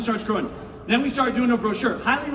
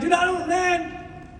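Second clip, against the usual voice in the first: tired mock whine; 6 semitones higher, much slower than usual. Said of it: "And you're like".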